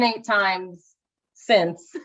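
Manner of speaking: ordinary delivery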